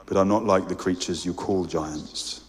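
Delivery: low, deep and unhurried, a great creature speaking softly; deep calm voice of a great lion; large deep voice. A narrator's reading. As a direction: voice a huge gentle being, low in the chest. The great voice continues.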